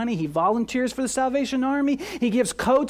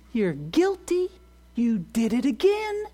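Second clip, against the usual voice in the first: accusing voice. Acted. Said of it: "Where do you hear that voice?".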